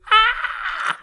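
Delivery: short high mock scream; demonstrated scream. Shown as a demonstration. Preacher shows how the disciples cried out, mock scream. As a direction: let out a sudden, high acted shriek. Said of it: "they screamed looking up".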